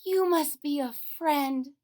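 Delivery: high, slow awed little bear; wondering teddy bear. A narrator's reading. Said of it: "said Corduroy".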